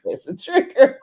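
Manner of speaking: high, loud laughing repeat; laughing echo. Real, not acted. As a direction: echo her line with a big laugh. Echoing friend, laughing.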